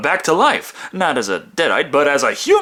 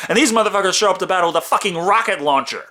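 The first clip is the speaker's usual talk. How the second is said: ordinary delivery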